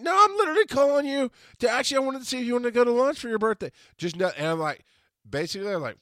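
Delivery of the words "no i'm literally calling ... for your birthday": high, loud, fast pleading; acted insistence, talking over someone; exasperated protest. Caller trying to reach crying friend, exasperated.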